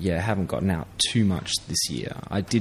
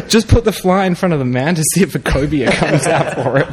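excited guide; 7 semitones higher, 8 dB louder than usual. Retold or imitated.

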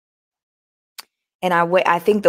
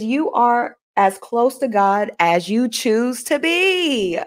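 slow, stretched-out reading with a long final BE; measured, drawn-out quotation; 3 semitones higher, slower than usual. Shown as a demonstration. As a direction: read it out slowly, stretching the last word. Reads out quote, then repeats it.

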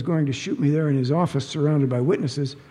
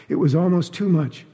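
usual speaking voice

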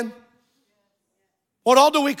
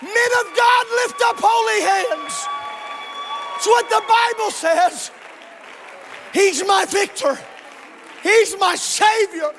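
full-force preaching; 10 semitones higher, slower than usual. Real, not acted. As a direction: cry it out to rally the congregation, drawing out key words.

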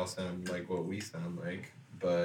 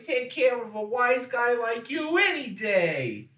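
put-on high, loud old New York newsboy; Newsies accent; 6 dB louder, much slower than usual. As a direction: talk tough like a Newsie. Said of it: "That's what they sound like to me".

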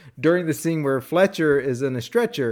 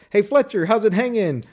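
higher-pitched mock greeting; imitated attorney; 6 semitones higher than usual. Attorney greeting Fletcher off the elevator, imitated. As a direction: call it out high, as a colleague would. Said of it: "other attorneys".

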